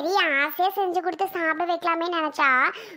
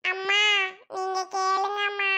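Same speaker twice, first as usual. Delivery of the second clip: put-on soft, slow child voice; a bashful kid pleading; shy little boy; 3 dB quieter, slower than usual. Boy shyly pleading with his mother, soft child voice.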